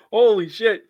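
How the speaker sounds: high, loud laughing disbelief; stunned by the fifty-one point line; shocked. Real, not acted. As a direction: gasp out real shock.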